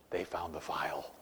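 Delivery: hushed whisper, as if sharing a secret; whispered punchline. Real, not acted. Telling the congregation a jail joke, whispered punchline.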